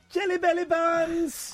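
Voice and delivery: high, slow sing-song pet voice; sing-songy baby talk. Shown as a demonstration. Host showing the voice everyone uses on their pets. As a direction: coo it like talking to a cat. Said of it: "We do this sing-songy voice".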